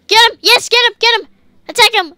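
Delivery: rapid-fire excited commands; excited egging on. Real, not acted. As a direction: cheer on the attack. Urging on an attack in game.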